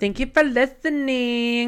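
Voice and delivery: high, slow lilt; singsong sign-off. Pretend. Thanking listeners at the episode's end, high slow singsong.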